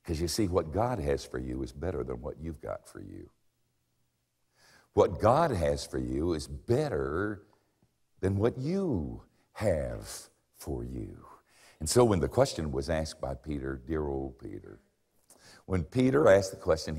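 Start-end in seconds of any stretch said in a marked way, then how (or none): 4.9-11.3 slow emphatic repeat. Shown as a demonstration. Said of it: "repeated for emphasis".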